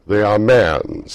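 loud, slow solemn spirit; grave spirit. Acted. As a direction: pronounce it gravely.

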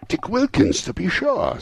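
high-pitched, pleased exclamation; lifted, wondering delight; delighted recognition. Acted. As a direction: recognise an old friend with excitement. Scrooge recognises his fellow apprentice.

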